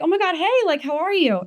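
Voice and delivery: high-pitched, gushy hello; re-enacted bubbly high greeting; excited greeting. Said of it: "I'm over here excited to see them".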